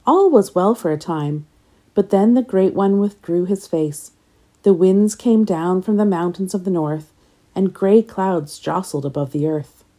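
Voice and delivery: ordinary delivery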